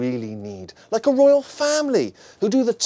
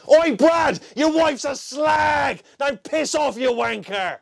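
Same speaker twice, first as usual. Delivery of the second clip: mock British yob shout; 9 semitones higher, slower than usual. A Brit mocks an American insulting Brad, shouting. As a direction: shout it like a pub lout. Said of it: "how sophisticated you'd sound when you're insulting someone".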